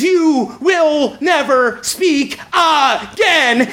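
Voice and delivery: very slow, word-by-word mock threat; furious threat, imitated. Furious section leader shakes the younger player, slow mock menace. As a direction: spell out each word as a warning. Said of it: "shaken furiously by Corn".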